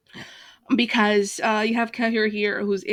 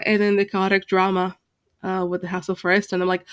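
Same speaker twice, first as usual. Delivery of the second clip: ordinary talk, nothing marked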